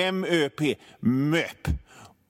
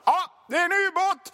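panicked submarine alarm. Retold or imitated. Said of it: "och så var det bara".

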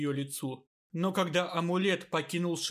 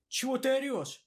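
high startled reply; 8 semitones higher than usual. A narrator's reading. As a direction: jump in fright and snap back. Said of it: "напугалась та".

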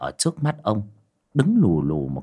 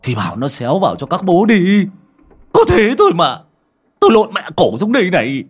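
much higher and louder rebuke; angry scolding; 8 semitones higher, 7 dB louder than usual. A narrator's reading. Master scolding the driver after his fall, angry yell. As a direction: tear into him for the fall. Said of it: "Cậu Tẩy nghe xong mắng".

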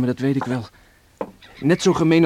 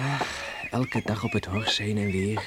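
dreamy whisper; 4 semitones lower, 8 dB quieter than usual. Acted. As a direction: whisper it as a fond daydream. Dreaming of home life. (dromerig gefluisterd)

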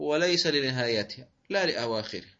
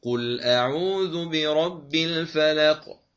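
loud, slow chant linking verse to basmala, then stopping; Quran recitation, forbidden way; 2 semitones higher, 6 dB louder, much slower than usual. Shown as a demonstration. Teacher demonstrates the disallowed fourth way of reciting. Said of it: "هكذا".

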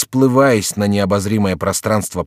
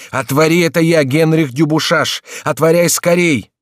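loud, high, urgent demand; frantic bellow at the door; roaring; 8 semitones higher, 5 dB louder than usual. A narrator's reading. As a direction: shout to be let in at once. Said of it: "страшно заревел молодой человек".

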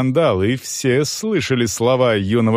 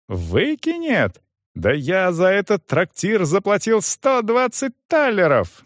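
high-pitched incredulous outrage; indignant outburst; 9 semitones higher than usual. A narrator's reading. Young man protests being thrown out, indignant. Youth outraged at threat of eviction.

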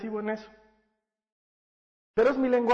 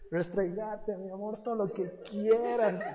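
softer, slow, doting coo with a laugh; tender cooing; 4 dB quieter, slower than usual. Retold or imitated. Father to his sick little daughter, slow tender coo. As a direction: indulge her gently, melting.

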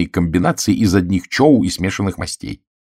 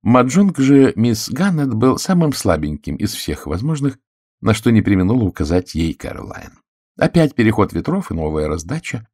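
usual speaking voice

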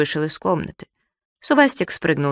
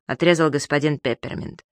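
ordinary delivery, nothing marked

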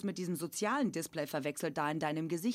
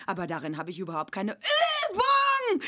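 shouted complaint; 2 semitones higher, 6 dB louder than usual. Acted.

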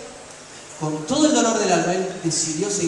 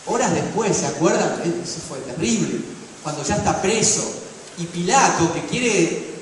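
normal talking voice

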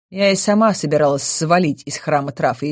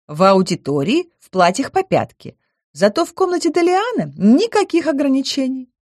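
high chirpy nymph voice; pixie; 4 semitones higher than usual. Acted. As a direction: chatter brightly, innocent.

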